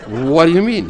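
loud, high-pitched disbelief; incredulous. Acted. Customer doubts the parrot claim.

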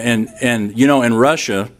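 ordinary talk, nothing marked

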